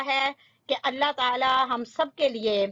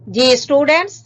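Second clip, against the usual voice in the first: calling the class; 12 dB louder than usual. Real, not acted. Teacher as the lesson page opens, loud call to students.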